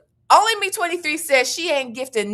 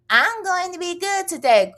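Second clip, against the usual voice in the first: perky reading of a chat; 7 semitones higher than usual. Retold or imitated. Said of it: "why you starting today?".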